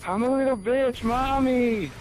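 whiny crybaby impression. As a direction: squeal it like a spoiled brat throwing a tantrum. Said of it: "I whine and bitch and complain".

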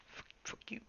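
breathy whispered swearing; whispered curse. Real, not acted. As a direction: hiss it under your breath. Whispered insult to character.

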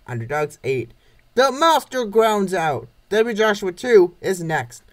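plain reading voice

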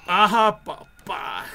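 sharp loud triumphant whoop; grito triunfal. Real, not acted. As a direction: bark it out like you just scored. Triumphant outburst in game. (grito triunfal de victoria)

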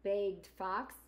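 gentle storybook narration; reading to children. Acted. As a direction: read it like storytime for kids. Reads picture book aloud.

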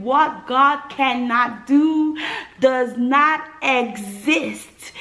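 reliving the moment of birth, slow and loud; slow proclamation. Retold or imitated. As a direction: proclaim each word slowly. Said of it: "the first thing I said".

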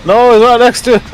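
very high, loud cry of disbelief; shrieking disbelief. Real, not acted. Player shrieking at the Russian teammate, very high. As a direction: squeal it out at the teammate's blunder.

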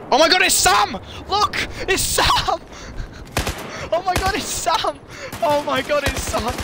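high loud thrilled yell; excited shout. Real, not acted. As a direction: scream with excitement at a friend. Excited, friend appears.

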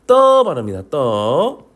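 떠 with a tense, unaspirated t; drawn-out 떠, tense at the onset; tense consonant. Shown as a demonstration. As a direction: say 떠 with a hard doubled ㄸ. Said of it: "된소리".

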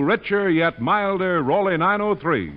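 normal talking voice

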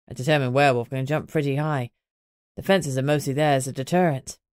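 calm mate reporting the pups are safe; Greg's voice. Acted.

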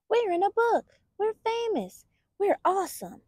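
high, squeaky little-bunny glee; excited baby bunnies. Acted. Baby bunnies cheering that they're in a book. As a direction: bounce it like a thrilled tiny bunny. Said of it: "The baby bunnies were beside themselves".

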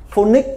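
"Phonics" pitched high, FO-nik; wrong phoenix, said as high Phonics; common Vietnamese mispronunciation. Shown as a demonstration. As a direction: Phonics with an o and a dropped s. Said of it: "tất cả Việt Nam nó phát âm là phonics".

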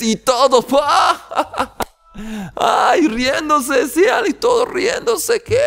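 entre carcajadas, voz mucho más aguda; riendo. Retold or imitated. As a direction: imitando la risa de todos los presos, muy agudo. Imita risas de los presos. (riéndose a gritos, imitando a los presos)

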